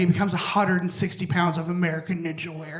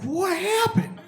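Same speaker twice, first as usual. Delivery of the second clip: mock mom; 11 semitones higher, slower than usual. Mom voiced in the story.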